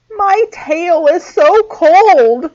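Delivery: high, slow sniffling mouse; sniffly mouse voice. A narrator's reading. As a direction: sniff it out like a tiny cold mouse. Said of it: "sniffed the mouse".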